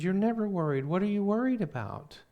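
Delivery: high-pitched, puzzled questioning; incredulous. Retold or imitated. Sister hears brother say he is worried, incredulous.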